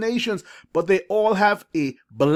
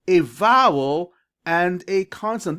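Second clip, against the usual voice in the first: ordinary delivery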